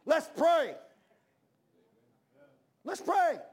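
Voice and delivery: loud public call to pray; bold call to prayer. Pretend. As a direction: ring out high and loud, Let's pray. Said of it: "I double dog dare you to stand up and say".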